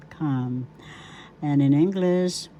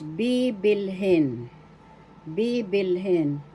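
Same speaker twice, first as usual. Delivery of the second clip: slow "bibilhin" with a long, stressed first "bi"; BII-bil-hin, drawn out for learners; stress on first syllable; slower than usual. Shown as a demonstration. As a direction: stretch the opening of "bibilhin". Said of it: "First syllable, bi, is the accent".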